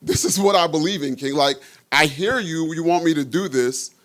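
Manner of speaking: defiant believers. Retold or imitated. Three young men defying the king, rising firm resolve. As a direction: stand your ground and build to it. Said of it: "They told him essentially".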